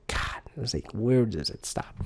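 soft, defeated sigh of a question; weary despair. Retold or imitated. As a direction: let it sink out of you.

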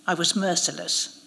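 plain reading voice

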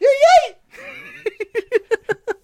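high loud laughing fit; squealing laugh. Real, not acted. Laughing at own impression.

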